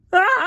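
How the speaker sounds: very high loud scream of falling. A narrator's reading. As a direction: shriek while tumbling down. Falling from tower.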